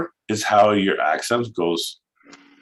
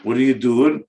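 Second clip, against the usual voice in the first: usual speaking voice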